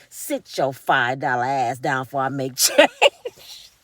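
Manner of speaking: low slow threat with a laugh; menacing gangster. Retold or imitated. Imagining her threatening ladies, low and slow. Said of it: "be like".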